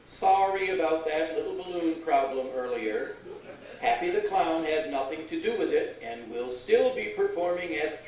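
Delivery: mall loudspeaker announcement; flat echoey pa page. Acted. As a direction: read it like a store PA.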